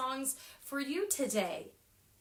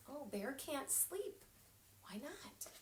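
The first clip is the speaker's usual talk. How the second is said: hushed; 2 semitones higher, 12 dB quieter than usual. A narrator's reading. Soft wondering aside.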